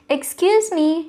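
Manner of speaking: polite shout ahead to Boar; called out. Acted. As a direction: call out to get attention. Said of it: "shouted Beaver".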